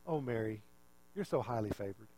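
soft, low, gentle address; hushed, affectionate angel; tender reply. Retold or imitated. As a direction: speak softly and low, as if favoring her. Angel gently favoring Mary, contrast.

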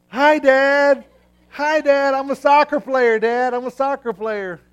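put-on high, loud, sing-song child calling to dad; a kid posing like a model, shouting up; proud little boy. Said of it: "like this, going".

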